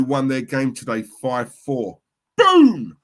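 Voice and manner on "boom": high, loud exploding shout; big high celebratory yell; celebratory boom. Real, not acted. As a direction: boom it out in triumph.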